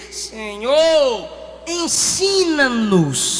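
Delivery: slow, low humble plea; súplica lenta. Acted. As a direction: ask humbly, drawing it out. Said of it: "foi a ele e disse". (súplica humilde e lenta)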